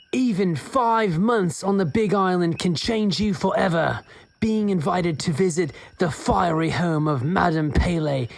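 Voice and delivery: slow, drawn-out pseudo-Australian accent. Shown as a demonstration. Host reads a back cover on a dare, pseudo-Australian accent. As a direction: ham up a mock Australian-British accent, a hint of Kiwi. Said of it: "in your best accent".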